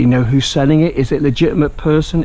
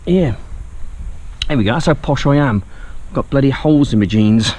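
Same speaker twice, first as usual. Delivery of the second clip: ordinary delivery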